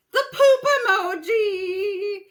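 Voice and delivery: loud, high, drawn-out singsong cheer; gleeful outburst. Real, not acted. Friend answers favourite emoji question, gleeful singsong shout. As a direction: belt it out slowly with silly glee.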